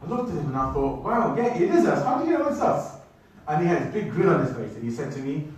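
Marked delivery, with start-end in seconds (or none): 1.0-2.9 much higher, astonished retelling; amazed reaction. Retold or imitated. Cyclist recognised by a kid at the airport, acted amazement. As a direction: act out delighted surprise, pitch leaping up. Retold surprise at being recognised.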